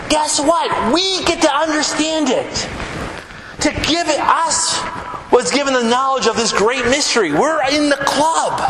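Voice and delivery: excited Paul. Retold or imitated. Paul telling believers the secret, excited and high. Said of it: "And Paul's like".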